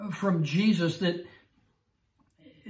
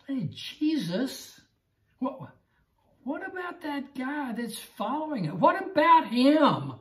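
higher, pleading voice of Peter asking about the other guy; a needling, higher what-about-him; whiny Peter; 8 semitones higher than usual. Acted. Peter whining to Jesus about the other disciple, higher pitched.

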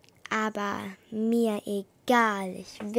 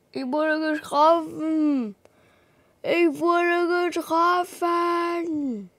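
higher, louder, pained call; wounded cry; 6 semitones higher, 6 dB louder, slower than usual. Acted. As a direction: cry out in pain. Wounded, calling for help. (getroffen aufschreiend)

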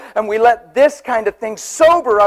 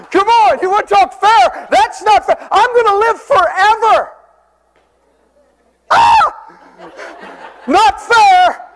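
preaching at full force; 9 semitones higher than usual. Acted. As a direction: shout the joy of eternal life.

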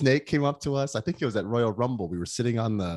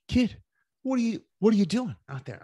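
high, put-on scolding voice of an old pro; mock-scold the rookie in a higher voice; Flair scolding; 12 semitones higher than usual. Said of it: "He's like".

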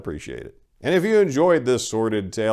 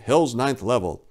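plain reading voice

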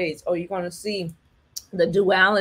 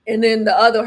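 normal talking voice

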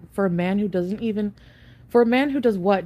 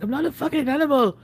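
fast, higher mock yell of his anger; retold angry yell; 7 semitones higher, 4 dB louder, faster than usual. Retelling boyfriend's outburst at the hotel, mock angry yell. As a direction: imitate his outburst, quick and sharp. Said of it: "he got really mad".

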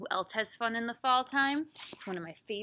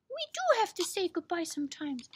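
high puppet voice; squeaky character voice; Snowball; 7 semitones higher, 3 dB louder than usual. Acted. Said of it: "Right, Snowball?".

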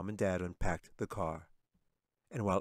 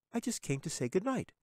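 cheerful; 11 semitones higher than usual. A narrator's reading. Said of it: "Katie said cheerfully".

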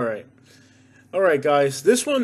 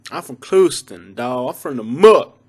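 street-talking local bragging about home; punchy local slang voice; Clewiston local; 6 dB louder than usual. Acted. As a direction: put on a proud homie from Clewiston. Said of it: "But the locals say Clewiston".

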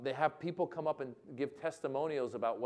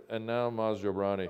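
DJ on mic; 5 semitones lower than usual. Retold or imitated. DJ in the corner introducing comedian, low-key mic voice. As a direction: drop it low like an unenthused DJ announcing. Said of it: "goes into his mic. He goes".

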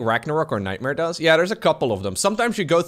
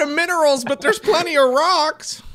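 Diva announcer; 5 dB louder than usual. Retold or imitated. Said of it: "a gamer girl voice or so".

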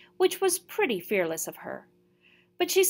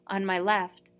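usual speaking voice

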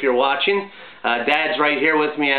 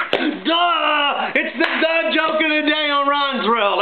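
excited shout; 9 semitones higher, slower than usual. Real, not acted. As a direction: yell the punchline tag with glee. Punchline of his joke.

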